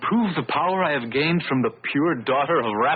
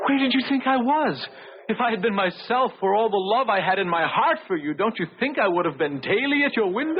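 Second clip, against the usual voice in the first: anguished accusation; 8 semitones higher than usual. Acted. Bitter accusation of Beatrice.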